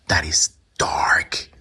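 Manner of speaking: slow awed whisper. Real, not acted. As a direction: whisper it slowly in awe. Reacting to dark room.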